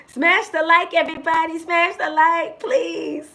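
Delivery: loud, high shout to smash the like; rally the viewers; big excited call for likes; loud appeal. Real, not acted. Begging viewers to like.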